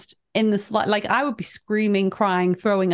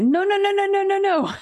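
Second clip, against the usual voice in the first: high panicked no; 11 semitones higher than usual. Retold or imitated. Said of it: "I'll be like".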